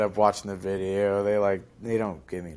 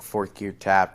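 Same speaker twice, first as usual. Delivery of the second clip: ordinary talk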